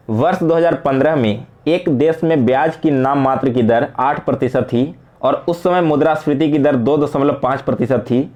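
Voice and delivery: ordinary talk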